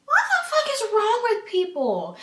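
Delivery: very high-pitched incredulous cry; exasperated outburst. Retold or imitated. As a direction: let the disbelief burst out high. Her exasperated reaction, retold.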